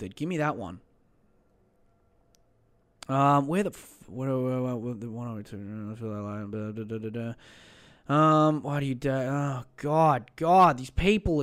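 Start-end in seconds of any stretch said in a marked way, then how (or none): 4.1-7.3 mumbled filler. Real, not acted. Mumbles while scanning posts.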